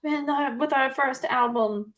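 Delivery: normal talking voice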